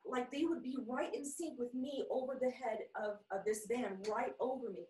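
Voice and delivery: normal talking voice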